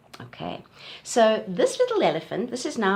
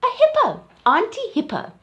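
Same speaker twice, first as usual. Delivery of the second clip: excited reveal; 12 semitones higher, 7 dB louder than usual. Shown as a demonstration. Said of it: "What's that?".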